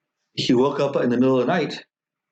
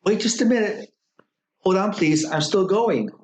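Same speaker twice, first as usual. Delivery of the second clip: higher, pleading call from inside; 8 semitones higher than usual. A narrator's reading. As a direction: call back through the door, stalling. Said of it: "the young boy called out".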